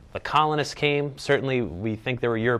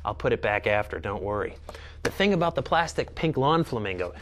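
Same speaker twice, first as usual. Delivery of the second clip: usual speaking voice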